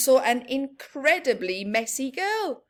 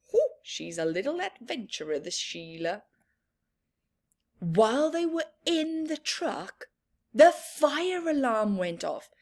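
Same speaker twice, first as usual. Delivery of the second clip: plain reading voice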